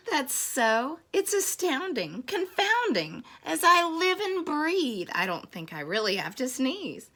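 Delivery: high, delighted storybook wonder; amazed elephant. Acted. Elephant realising it needn't sneeze, amazed.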